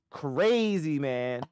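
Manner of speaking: high, loud exclamation; loud disbelief. Real, not acted. Astonished at missed profit.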